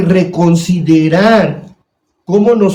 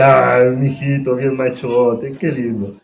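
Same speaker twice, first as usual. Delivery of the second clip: doting father; 2 semitones lower, slower than usual. Retold or imitated. Preacher voicing a father praising his violent little boy, mildly. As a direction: coo it like a proud father. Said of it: "Y papá dice".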